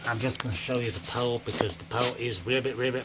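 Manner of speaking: low, hushed, rapid patter; quiet, hurried low-pitched aside; muttered rambling. Acted. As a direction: mumble it quickly under your breath. Rambling aside before sudden outburst.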